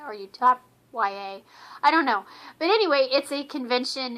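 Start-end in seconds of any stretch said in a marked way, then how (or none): 0.1-1.4 "Utop Y A" as "YOU-top why-ay"; spelled-out ending. Shown as a demonstration. Vlogger repeating the other way to say it, letters spelled out. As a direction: finish "Utop Y A" on two separate letter names. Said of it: "depending on how you wanna say it".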